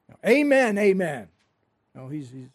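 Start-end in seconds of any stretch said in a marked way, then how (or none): 0.1-1.3 forceful stress on "amen, amen"; emphatic. Shown as a demonstration. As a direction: land hard on "amen, amen". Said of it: "very emphatic".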